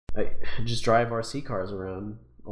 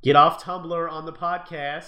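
slow, raised, insistent order; insistent louder repeat; 5 semitones higher, 6 dB louder, slower than usual. Acted. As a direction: say it again, higher and firmer.

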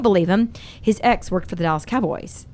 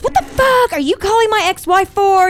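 high, louder mock outrage from the ex; imitating his anger; 10 semitones higher, 4 dB louder than usual. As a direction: play the boyfriend snapping back, pitched up. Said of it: "he got all pissed off at me".

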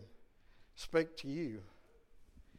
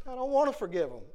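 mock protest, pitched higher; reluctant protest; 6 semitones higher, faster than usual. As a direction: act out a balky refusal in a raised pitch. Acted protest to God's direction.

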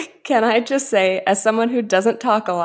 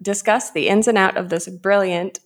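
usual speaking voice

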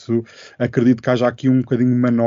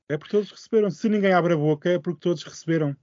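populist rant; 7 semitones higher, 4 dB quieter, faster than usual. Acted. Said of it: "Lá está o nosso populista de serviço".

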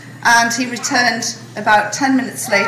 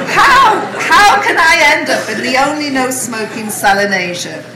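laughing; 4 semitones higher, 7 dB louder than usual. Real, not acted.

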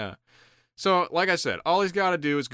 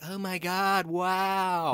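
higher, put-on gushing; mock awe; 6 semitones higher, much slower than usual. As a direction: imitate an easily impressed socialite. Imitating everyone's awed reaction to guru.

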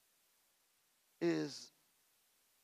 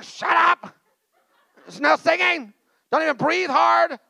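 mock stern dad; 10 semitones higher, 12 dB louder than usual. Dad snapping at singing kids.

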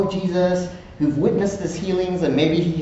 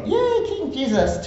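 mock cheer; 13 semitones higher than usual. Crowd cheering Jesus.

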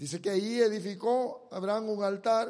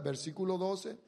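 ordinary talk

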